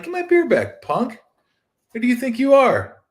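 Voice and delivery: high, loud indignant yell to the beer-taker; mock angry demand. Star yelling at the man who took his beer, high and loud. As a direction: play the outraged star reclaiming his beer. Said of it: "I'd have been like".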